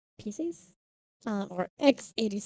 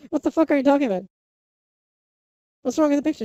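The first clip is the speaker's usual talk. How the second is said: louder, higher mock exasperation; retold incredulous reaction; 4 semitones higher, 4 dB louder, much faster than usual. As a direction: quote a friend's baffled pushback. Repeating friend's blunt reaction to picture.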